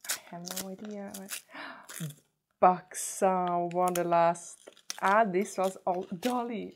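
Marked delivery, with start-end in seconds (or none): 1.2-2.1 quick breathless gasp; surprised gasp. Real, not acted. Opening next package. 2.6-6.7 louder thrilled reveal; excited. Real, not acted. Excited at the next gift.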